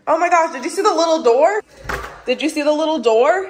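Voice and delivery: loud, high excited question. Real, not acted. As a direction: ask it thrilled and loud. Spotting a tiny door.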